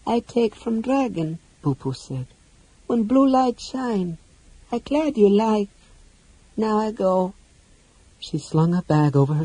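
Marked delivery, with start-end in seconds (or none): 0.1-1.4 high, soft, slow little voice; childlike creature. Acted. Boo-Poo explains where the book came from, childlike. 2.9-7.3 high, small, halting voice; childlike creature. Acted. Boo-Poo says goodbye after her gift, childlike.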